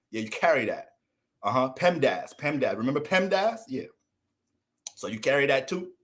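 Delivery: normal talking voice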